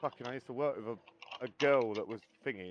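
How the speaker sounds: ordinary delivery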